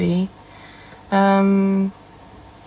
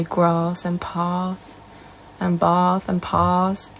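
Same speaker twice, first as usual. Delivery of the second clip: slow, long southern 'ah' in grass, path, bath and pass; southern English accent; 4 dB quieter, much slower than usual. Retold or imitated. Northerner imitating southern friends, drawn-out southern English vowels. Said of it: "My friends are southern. They say".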